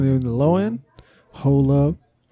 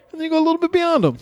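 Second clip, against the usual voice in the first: high pitched voice effect; 12 semitones higher, much faster than usual. Pretend. Pitch-shifted mixer voice.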